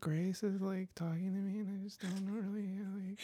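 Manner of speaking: put-on high, small, fading kid voice; scared eight-year-old. Kid overwhelmed by talks about puberty, mock scared. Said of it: "like, eight-year-old".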